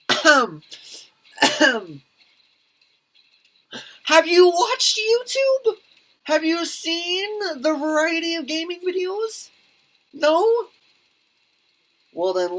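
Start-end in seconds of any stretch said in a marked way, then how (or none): 0.1-2.0 throat clear. Acted. Clearing throat before a speech. 4.1-10.7 high, slow, loud know-it-all voice; lecturing the mom about gaming videos, high and drawn out; mock lecture.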